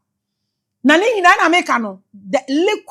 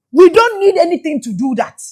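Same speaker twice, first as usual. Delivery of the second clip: ordinary talk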